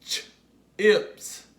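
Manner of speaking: "Ch-ips" split, a long pause after "ch"; sounded-out word. Shown as a demonstration. Teacher leads the class to say it along, "Ch-ips" split in two. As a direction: isolate the "ch", then release "ips". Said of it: "Say it with me".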